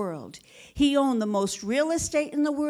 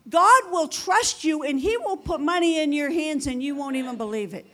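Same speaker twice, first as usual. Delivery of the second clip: raised, louder preaching that keeps climbing; full-force preaching; 6 semitones higher, 4 dB louder than usual. Acted. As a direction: preach it out hard to the congregation.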